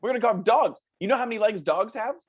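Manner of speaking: put-on chirpy children's show host talking to kids; lightly voiced kids' TV host, upbeat. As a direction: sell it like a cheesy kids' show presenter.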